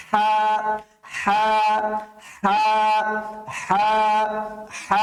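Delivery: হা breathed hard from the middle of the throat; throaty ha demo. Shown as a demonstration. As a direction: push the air out forcefully for হা. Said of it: "এভাবে করে উচ্চারণ করতে হবে".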